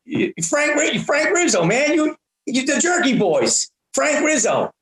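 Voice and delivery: a fan getting worked up, tough-guy style; excited tough guy. Retold or imitated. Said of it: "a tough guy voice".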